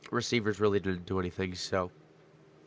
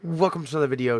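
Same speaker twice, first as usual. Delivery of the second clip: high, loud, pumped-up greeting; hyped YouTube intro; 8 semitones higher, 6 dB louder than usual. Acted. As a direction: open the video like a hype host. Energetic channel intro greeting.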